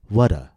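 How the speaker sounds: "What a" run together as "wha-da", the T a light quick D; linked tap. Shown as a demonstration. Teacher models linking "What a" with a tap across the words. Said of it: "we said".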